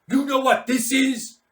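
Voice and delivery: loud, high taunting question; menacing officer. Acted. Mad Mike showing the lieutenant his pistol, loud taunt. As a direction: wave the gun and taunt him.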